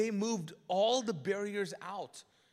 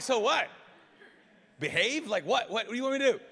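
loud, high, incredulous what-do-you-want; hammed-up outrage at being told to behave; comic disbelief, voice thrown up loud; mock exasperated; 3 semitones higher, 7 dB louder, faster than usual. Mock exasperated reply to friend.

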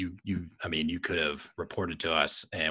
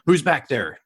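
big loud shout from the dark; booming voice; 9 semitones higher, 11 dB louder than usual. A narrator's reading. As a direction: boom it out like a soldier. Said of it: "a booming voice".

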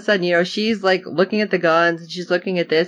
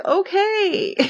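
high, drawn-out "okay"; mock-willing little squeak; imitated meek agreement; 13 semitones higher than usual. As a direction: agree like a hapless pushover. Acts bashed character meekly agreeing.

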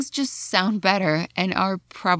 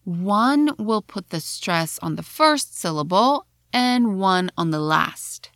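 ordinary delivery

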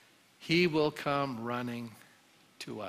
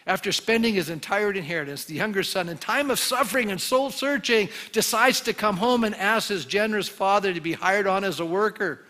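preaching; 5 semitones higher, 5 dB louder than usual. Acted. Preacher builds the story.

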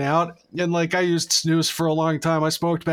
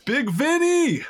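high, excited cheer; big high-pitched name call; mock hype shout; 10 semitones higher, slower than usual. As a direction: hail him like a local celebrity. Hyping the friend's dentist by name.

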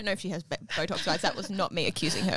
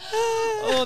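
delighted shout; 13 semitones higher than usual. Real, not acted. Laughing at the Romelda rhymes.

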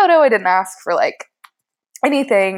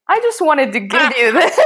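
laughing shyly; 8 semitones higher than usual. Retold or imitated. Retold shy gift giving.

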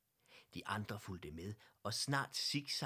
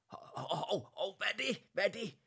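rising, alarmed shouting, much higher and louder; 10 semitones higher, 6 dB louder than usual. A narrator's reading. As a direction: shout in alarm at something in the dark. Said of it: "lød der et højt råb".

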